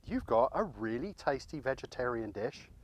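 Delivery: ordinary talk, nothing marked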